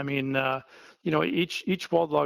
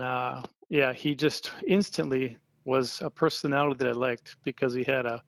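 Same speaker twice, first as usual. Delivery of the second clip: ordinary talk, nothing marked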